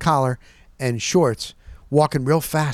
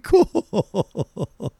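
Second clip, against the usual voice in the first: a dry cool said through a laugh; 8 semitones higher than usual. Real, not acted. Laughing at the porn-star bragging guy. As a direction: laugh it off, unimpressed.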